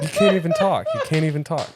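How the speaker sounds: loud, high laughing tease; amused outburst over giggles. Real, not acted. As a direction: tease through your laughter. Cracking up after the call.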